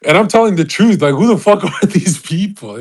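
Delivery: loud amused rant; loud laughing outburst. Real, not acted. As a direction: blurt it out laughing.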